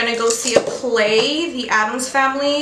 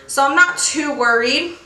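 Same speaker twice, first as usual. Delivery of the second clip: ordinary delivery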